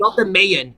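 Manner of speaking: "Mayan" said as MAY-en; wrong pronunciation. Shown as a demonstration. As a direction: say "Mayan" with a first syllable like "may". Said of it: "It's not mayonnaise. It's Mayan".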